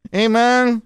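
bad put-on Mexican accent; drawn-out mock Mexican greeting. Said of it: "your Mexican sounds like Al Pacino's Cuban".

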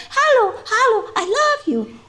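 squeaky high little dog voice; dog's voice. Retold or imitated. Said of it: "sometimes he said".

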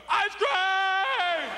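high, loud yell; calling out. Retold or imitated. Kid chasing the ice cream truck, high loud yell. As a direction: shout after the truck.

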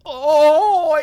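long loud high "ohhh"; hammed-up yell of effort; mock straining cry. Pretends the key slides in.

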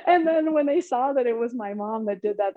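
ordinary talk, nothing marked

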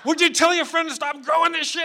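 high, loud menacing demand; freaked-out cop yelling. Retold or imitated. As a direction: shout it like a cop gone crazy. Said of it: "starts screaming all this psycho stuff".

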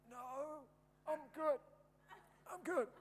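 put-on a winded boy forcing out that he's fine; strained high boy. Hurt boy brushing off the teacher, squeaky and strained.

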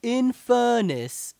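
put-on high, loud, drawn-out British accent, "fairness" as "furnace"; loud mock British accent, vowels pulled long; Johnny Vegas impression. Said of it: "sounds like he's saying furnace".

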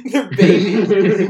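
said through laughter; laughing. Real, not acted. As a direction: laugh while saying it. Laughing remark.

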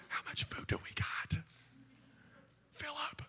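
hushed whisper behind the bravado; whispered aside. Acted. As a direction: whisper it secretly to a helper. Jesus secretly asks Philip.

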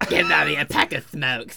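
raspy smoker mom voice; cigarette voice. Acted. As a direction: croak it like a heavy smoker. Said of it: "I missed the cigarette voice".

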